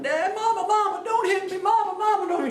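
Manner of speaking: imitated high-pitched frantic holler; hollering boy. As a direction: act out a kid pleading at the top of his voice. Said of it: "he's hollering".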